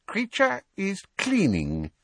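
high, put-on elf voice; shifty little house-elf; evasive. A narrator's reading. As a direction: dodge the question in a high servile voice. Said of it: "he said evasively".

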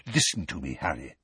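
hushed, urgent warning to Harry. A narrator's reading. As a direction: press it low and quiet. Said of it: "he said urgently".

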